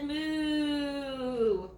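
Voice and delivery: called-out mock cow moo. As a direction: moo like a cow across the field. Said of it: "The cow says".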